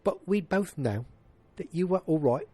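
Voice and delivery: high-pitched, not very good British impression; Michael Caine. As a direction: do a poor, high British actor voice. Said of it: "That's my Michael Caine. It's not very good".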